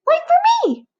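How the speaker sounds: very high, loud plea; desperate call. Acted. Butterfly shouting to the departing friend, very high and loud. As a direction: shout after them so you're not left behind.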